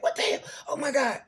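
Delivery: rising into spooked disbelief; freaked-out panic. Retold or imitated. Friend panicking at ghostly orb on video. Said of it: "he freaks the freak out".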